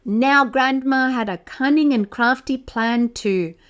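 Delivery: usual speaking voice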